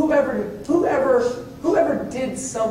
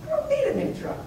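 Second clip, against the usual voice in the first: incredulous; 11 semitones higher, 5 dB quieter than usual. Retold or imitated. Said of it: "and I'm thinking".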